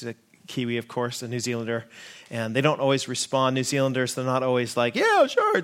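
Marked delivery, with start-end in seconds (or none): 4.9-5.6 high-pitched, breezy Australian yeah sure. Retold or imitated. Mocking the cheery Australian reply to the congregation, much higher Australian voice. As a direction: toss it off like a cheery Aussie, pitched way up. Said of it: "that's Australian".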